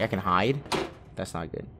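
ordinary talk, nothing marked

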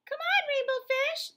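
high, loud playful call; calling fish. Acted. Other fish calling Rainbow Fish to come and play. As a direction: call out across the water. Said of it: "they would call".

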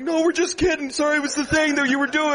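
high-pitched hurried backpedaling; hammed-up placating fans; imitated startled apology. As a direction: apologize quickly in a high mock-frightened voice. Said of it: "these guys jump like".

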